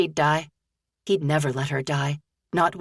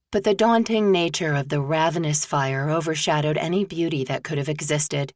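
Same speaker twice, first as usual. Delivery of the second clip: usual speaking voice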